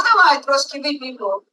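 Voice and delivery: ordinary talk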